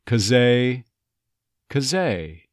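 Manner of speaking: "'cause they" run together as "kuh-ZAY"; "'cause they" as one word, no TH; dropped-TH linking. Shown as a demonstration. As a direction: drop the TH in "'cause they", link the Z into "ay". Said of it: "And here, same thing".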